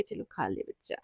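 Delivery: put-on soft, hushed coaxing to a sulking child; a mother gently coaxing her child to eat; whispered, tender mom voice. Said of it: "फिर mummy खाना बना के परोस के देती है".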